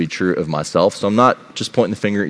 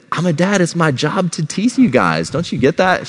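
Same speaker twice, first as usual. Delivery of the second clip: high-pitched mock defense; exaggerated self-justifying protest; teasing dad; 12 semitones higher than usual. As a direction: play the joking dad justifying himself. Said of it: "And I said".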